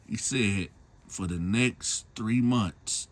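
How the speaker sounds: normal talking voice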